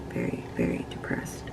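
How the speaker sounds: fading to a whisper on depressed. Real, not acted. Vlogger confessing her depression in a whisper. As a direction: let the words sink into a whisper.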